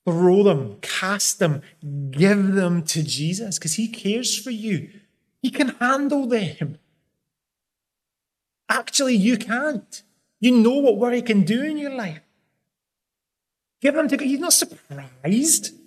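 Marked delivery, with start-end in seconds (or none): 5.4-15.8 raised-pitch urgent preaching; impassioned preaching. Acted. Preacher urging listeners to trust, impassioned. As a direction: press the point home to the congregation.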